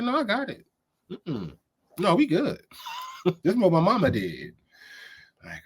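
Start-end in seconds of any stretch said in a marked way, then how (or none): 0.0-4.5 lightly mocking, higher-pitched cook waving off advice; breezy brush-off. Cook waving off the judge's warning, breezy and mock-confident. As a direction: play the cook who is sure of herself.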